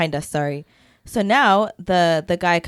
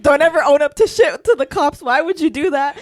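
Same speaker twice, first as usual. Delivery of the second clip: loud, higher-pitched scolding; imitated lecturing; 6 semitones higher, 4 dB louder than usual. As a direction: imitate a friend telling someone off, raised voice. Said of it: "Iman starts lecturing".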